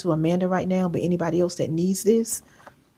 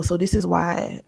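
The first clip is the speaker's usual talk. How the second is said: normal talking voice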